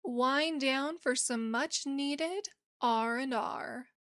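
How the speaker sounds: hushed radio-style welcome; podcast intro read. Acted.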